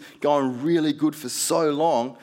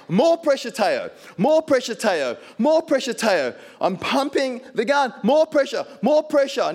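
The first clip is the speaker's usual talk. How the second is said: urgent urging; 9 semitones higher than usual. Retold or imitated. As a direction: drive each word like a coach urging on. Spirit urging while he pumps.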